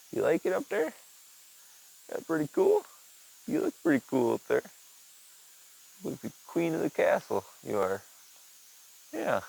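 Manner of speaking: high sing-song pet voice; talking to the cat. Real, not acted. Baby-talking to his cat.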